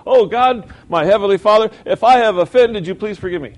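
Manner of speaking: loud, high, hammed-up showy prayer; mock grand prayer. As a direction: perform a hollow prayer for show. Mocking a hollow prayer of faith.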